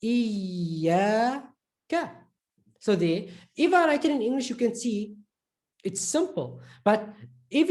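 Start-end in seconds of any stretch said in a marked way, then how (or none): 0.0-2.1 iyaka with a long iyy before the a; stressed, held ya. Shown as a demonstration. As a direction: hold the y in iyaka, slowly. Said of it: "stressing it and holding it a bit".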